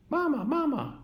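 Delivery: very high tiny chick voice; peeping gosling. A narrator's reading. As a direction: peep it like a lost baby bird. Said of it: "peeped the gosling".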